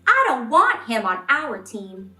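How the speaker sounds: ordinary delivery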